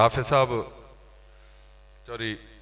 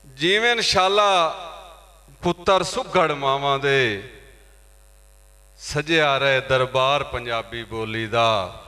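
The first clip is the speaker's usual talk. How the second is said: high ringing openings, settling on the refrain; poetry recitation; 4 semitones higher, 5 dB louder, slower than usual. Acted. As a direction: declaim proudly to the hall. Recites poem on Punjabi language. (ਉੱਚੀ ਸੁਰ ਵਿੱਚ ਕਵਿਤਾ ਦਾ ਪਾਠ)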